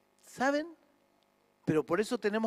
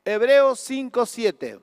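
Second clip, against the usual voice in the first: loud call out; 3 semitones higher, 9 dB louder, slower than usual. Real, not acted. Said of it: "el proyector, chicos".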